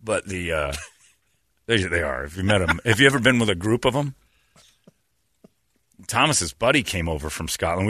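ordinary delivery

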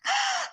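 sudden high-pitched laugh breaking out; high burst of laughter. Real, not acted. Laughing at herself while telling her friend about apologising. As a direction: laugh it off, caught out by her own habit.

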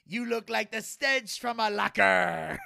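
slow, stretched-out shouted jeer; mock shouted comeback. Patient's retort to the ghost, slow mock shout. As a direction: drag the insult out into a shout.

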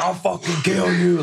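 high-pitched mock death threat; retold furious threat; retold red-faced rage. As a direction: act out losing it completely, voice up high. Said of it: "Like, all red and, like, mad".

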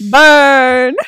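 loud gleeful shout of burn; burn call-out. Real, not acted. Reacting to her cutting remark, loud gleeful shout. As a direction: call it out like a crowd reacting to a roast.

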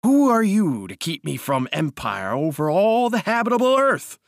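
high, ringing cry of ambition; grandiose, swelling rant; imperious outburst. A narrator's reading. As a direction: declaim it like a mad would-be king, rising to a high peak. Would-be king rants at rival.

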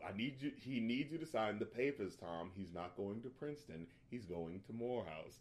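low, controlled, no room for argument; calm but firm. Retold or imitated. Wife tells husband the son's choice, quietly firm. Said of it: "saying it in that sort of, I'm saying this calm".

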